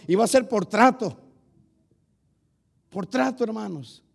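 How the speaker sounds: loud, driving sermon to the congregation; fiery preaching. Acted. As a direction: preach it out at full force. (predicando a todo pulmón)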